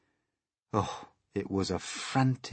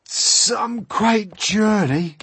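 high, loud, very drawn-out exclamation; slow, loud figure voice; centipede character; 13 semitones higher, 10 dB louder, much slower than usual. A narrator's reading. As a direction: stretch it out in a big centipede voice. Centipede character voice after rough trip.

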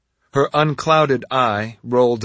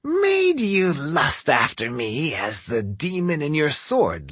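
sly; 5 semitones higher than usual. A narrator's reading. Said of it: "her voice turning sly".

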